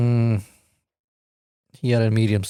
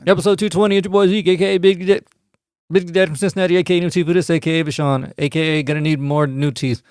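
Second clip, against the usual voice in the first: podcast intro hype; 6 semitones higher than usual. Acted.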